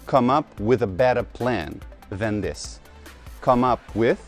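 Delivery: usual speaking voice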